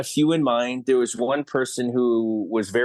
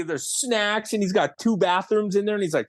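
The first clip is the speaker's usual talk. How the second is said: ordinary delivery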